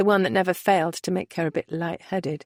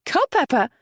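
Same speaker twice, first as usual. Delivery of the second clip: higher, surprised outburst; baffled exclamation; 8 semitones higher, 3 dB louder than usual. Acted. Merritt baffled at Keir's choice, surprised exclamation. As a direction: blurt the name in disbelief. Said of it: "Merritt exclaimed, baffled".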